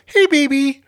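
high, sweet pet-talk greeting; sweet to her. Shown as a demonstration. Showing how to greet the dog, high and sweet. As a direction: lift it up and take the bass out. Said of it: "You gotta be sweet to her".

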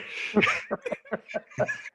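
high breathy laughter; hearty laugh. Real, not acted. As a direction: laugh out hard. Laughs after punchline.

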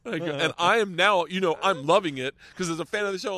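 ordinary delivery

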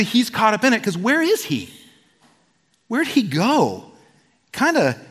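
ordinary talk, nothing marked

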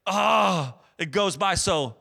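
drawn-out, exaggerated slog, last word long and loud; dragging weary complaint. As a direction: groan it out, stretching the big words. Acting out dragging work weeks.

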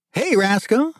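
high-pitched excited greeting; excited hail to an old friend; excited shout. A narrator's reading. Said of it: "he shouted excitedly".

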